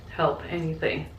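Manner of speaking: ordinary delivery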